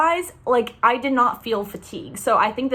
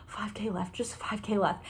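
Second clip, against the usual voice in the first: soft whispered countdown; 12 dB quieter than usual. Retold or imitated. Runners around her murmuring the distance left, in a whisper. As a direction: breathe it out under your breath. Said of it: "everybody around me was being like".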